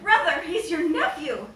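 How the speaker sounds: loud, high, slow cry; shouted plea. A narrator's reading. Sancha shouting at her brother to spare her son. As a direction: yell to stop him. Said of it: "Sancho shouted".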